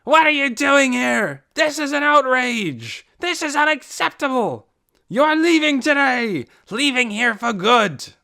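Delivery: high, loud outraged yelling; furious yell. A narrator's reading. Ben yelling at the crowd from the town hall steps. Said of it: "and yelled back".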